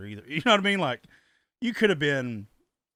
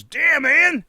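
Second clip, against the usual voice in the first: raised, loud imitation of a blurting guest; mock loud exclamation; 11 semitones higher, 10 dB louder than usual. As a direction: blurt it out for the whole room. Acted quote of loud blurting guest.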